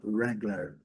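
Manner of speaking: American "Wrangler" with final R sounded; American pronunciation. Shown as a demonstration. Teacher contrasting accents for learners, American R ending. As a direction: curl the last R of American "Wrangler". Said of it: "E no inglês americano".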